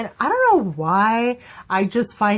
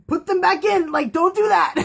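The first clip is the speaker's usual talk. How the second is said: higher, pleading with the screen; mock pleading; 6 semitones higher than usual. Fan begging Simon to hide his fangs. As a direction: beg Simon to stop. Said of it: "I'm like".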